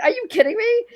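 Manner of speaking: high, astonished question; retold disbelief. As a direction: ask it as if you can't believe it. Retelling her own disbelief.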